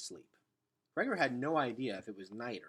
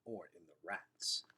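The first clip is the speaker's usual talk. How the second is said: muttered; 5 semitones lower, 6 dB quieter than usual. A narrator's reading. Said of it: "muttered Luxa".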